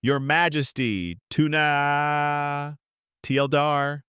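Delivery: slow, high, drawn-out royal proclamation; hammed-up high dramatic call; dramatic mock scream. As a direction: call it out theatrically with long held vowels. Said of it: "dramatically screaming".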